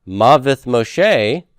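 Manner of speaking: mavith Moshe very slow and deliberate; Hebrew phrase. Shown as a demonstration. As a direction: spell out mavith Moshe word by word. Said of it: "talk about the death of Moses".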